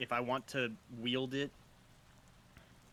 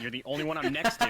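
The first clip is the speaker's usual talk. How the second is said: excited; 13 semitones higher, 8 dB louder, faster than usual. Real, not acted. Laughing outburst at GM.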